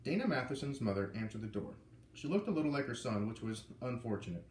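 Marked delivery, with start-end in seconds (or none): none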